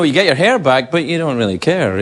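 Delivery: ordinary talk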